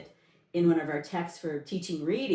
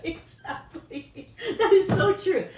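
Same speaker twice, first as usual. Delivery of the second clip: laughing; 11 semitones higher, 5 dB louder, slower than usual. Real, not acted. Laughing agreement.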